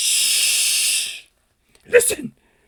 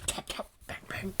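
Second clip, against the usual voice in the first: whispered sound effects; 4 semitones lower, 15 dB quieter than usual. Acted. Sound of nailing pages.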